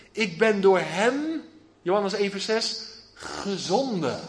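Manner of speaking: ordinary talk, nothing marked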